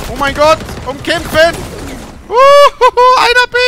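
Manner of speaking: very high, loud frantic whoops; frantic excited yelling. Real, not acted. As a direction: yell in wild excitement. Frantic fight at objective.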